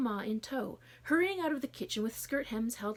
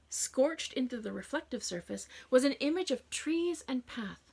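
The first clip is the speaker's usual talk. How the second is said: plain reading voice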